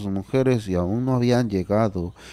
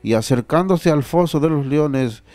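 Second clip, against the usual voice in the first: normal talking voice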